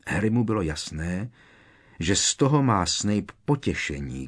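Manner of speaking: ordinary delivery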